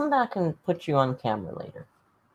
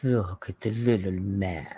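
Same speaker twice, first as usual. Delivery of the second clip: tender cat talk; 9 semitones lower, slower than usual. Real, not acted. Cooing at the cat.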